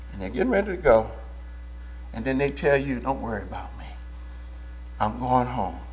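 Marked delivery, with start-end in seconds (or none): 3.0-5.8 soft dying words. Retold or imitated. Dying believer quoted softly.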